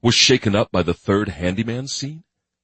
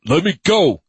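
loud plea; 11 semitones higher, 7 dB louder than usual. A narrator's reading. Captive man pleading loudly.

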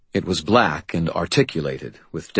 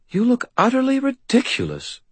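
fairy godmother voice. A narrator's reading. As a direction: look down your nose at him. Leah's disapproving remark.

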